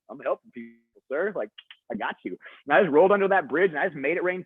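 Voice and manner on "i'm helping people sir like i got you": innocent mumble. Retold or imitated. Caught taking the box, answering coach.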